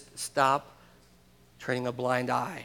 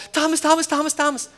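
higher, louder repeated calling of a name; eager calling out; 12 semitones higher, 5 dB louder than usual. Retold or imitated. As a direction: call him over, bursting with news. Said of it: "they go".

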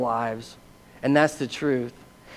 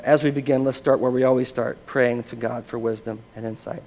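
normal talking voice

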